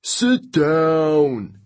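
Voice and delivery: high, rough-edged camper's jeer; heckling yell. A narrator's reading. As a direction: yell it down at a rival. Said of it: "yelled one of the Ares campers".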